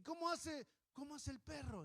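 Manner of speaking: doting parent. Retold or imitated. Adults asking the baby.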